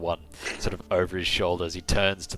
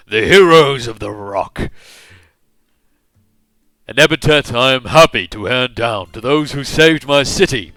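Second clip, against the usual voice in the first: loud, raised pompous royal proclamation; drunken king hailing the heroes, booming; 7 semitones higher, 17 dB louder than usual. Acted. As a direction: proclaim it grandly to the whole hall. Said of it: "booming voice".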